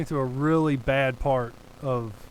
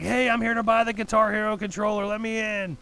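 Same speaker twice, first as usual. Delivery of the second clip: higher, raised call through a closed door; 7 semitones higher than usual. Retold or imitated. Banging on her door, he calls out to be let in. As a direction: announce yourself to whoever's inside.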